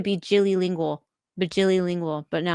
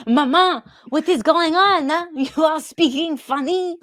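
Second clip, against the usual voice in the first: put-on high-pitched little child's voice, baffled; a small child puzzled by mama's funny talk; 7 semitones higher, 3 dB louder than usual. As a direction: play the kid asking mama what is going on. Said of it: "Zelda Bo is like".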